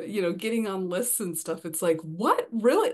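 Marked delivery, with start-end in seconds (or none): 2.0-2.9 disbelief rising higher and louder; astonished little double-take; blown away. Retold or imitated. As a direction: react as if you can't believe it. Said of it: "I am just blown away".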